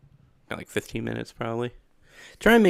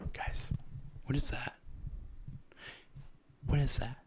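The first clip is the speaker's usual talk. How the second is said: nervous whisper, hushed and tense; 13 dB quieter than usual. Pretend. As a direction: creep in quietly, as if something lurks. Streamer acting scared before jumpscare.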